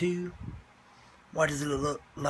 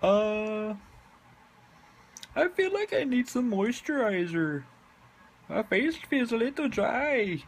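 much higher cartoon voice; high squeaky voice; 9 semitones higher than usual. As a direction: pitch up, squeaky and light. High character voice.

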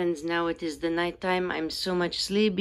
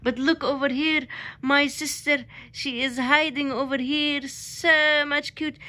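cooing at the cat; 8 semitones higher than usual. Real, not acted. Man cooing at his hiding cat.

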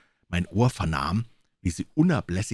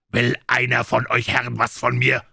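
Silver roars a challenge, a touch louder; roaring; 4 semitones higher, 5 dB louder than usual. Acted. Silver roars a challenge at his crew. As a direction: bellow the challenge. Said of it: "brüllte Silver".

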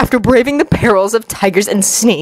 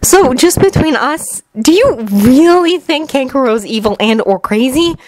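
higher-pitched probing question; confiding Temari; 6 semitones higher than usual. Acted. Temari asks Naruto aside, high and probing. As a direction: start slowly, then press the question.

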